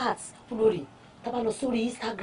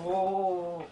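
ordinary delivery

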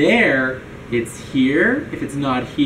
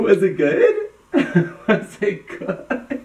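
asked through real laughter; laughing question; slower than usual. Real, not acted. Host to friend who just drank the smoothie, laughing. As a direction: laugh while asking.

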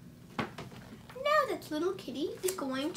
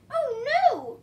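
dismayed cry; 6 semitones higher, 10 dB louder than usual. Acted. Rabbit dismayed, loud.

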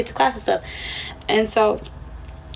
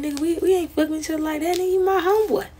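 higher, faster, exasperated brush-off; exasperated friend-zoning; 7 semitones higher, faster than usual. Retold or imitated. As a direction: set him straight, half-laughing and fed up. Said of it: "I'm like".

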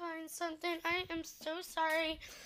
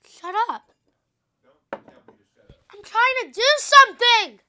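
loud, high, slow annoyed yell; exasperated shout at dad; snapping at dad; 7 semitones higher, 19 dB louder, slower than usual. Real, not acted.

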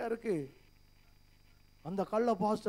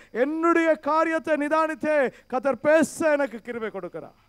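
loud, raised preaching voice, pressing the questions; forceful preaching; 4 semitones higher, 8 dB louder than usual. Acted. Pastor challenging the brothers in church, loud forceful preaching. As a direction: hammer each question at the congregation.

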